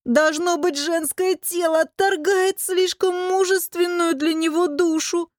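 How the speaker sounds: high, slow whining wail; howling complaint. A narrator's reading. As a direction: moan dramatically about one's own suffering. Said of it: "Выл не хуже голодного Клавдий Одинцов". (жалобно воет, высоко и протяжно)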